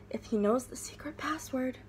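secretive whisper, barely voiced; hushed password whisper. A narrator's reading. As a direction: lean in and breathe the words. Tia Mia whispers secretly.

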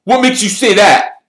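loud, high, irritated question; testy. A narrator's reading. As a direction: snap back testily at a teammate. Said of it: "said Wood testily".

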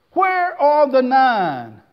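slow question. Acted. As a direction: draw out each word. Lord asks slowly.

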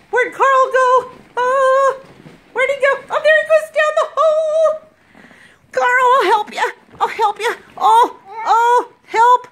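high, loud mock panic; playful mock alarm. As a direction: cry out like rescuing him. Mock rescue of toy.

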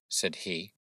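usual speaking voice

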